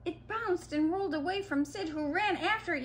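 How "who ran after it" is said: ordinary delivery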